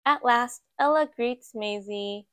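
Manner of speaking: usual speaking voice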